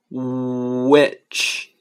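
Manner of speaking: "which" as long oo, short i, final ch; slow sound blending. Shown as a demonstration. Teacher joining the three sounds of which, slow stretched demonstration. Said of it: "一个是".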